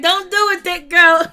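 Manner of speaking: loud, high imitation of a warning; mock shouted warning. Host quoting a warning to thick girl, mock shouting. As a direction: yell it out, imitating an alarmed plea.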